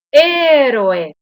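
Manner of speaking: Héroe with a loud, high, long É; stress on first syllable. Shown as a demonstration. Teacher giving an esdrújula example, first syllable stressed in Héroe. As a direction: hit the opening É of Héroe. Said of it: "palabra esdrújula".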